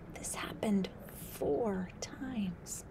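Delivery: slow ominous whisper. A narrator's reading. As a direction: let it hang, whispered.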